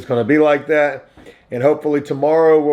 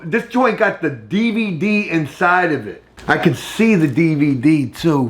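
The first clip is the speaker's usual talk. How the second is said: normal talking voice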